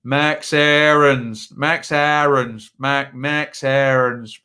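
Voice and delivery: drawn-out Norfolk vowels on Max Aarons, slow; slow, broad Norfolk accent saying Max Aarons. Retold or imitated. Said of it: "in Norfolk accent".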